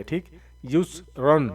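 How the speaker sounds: normal talking voice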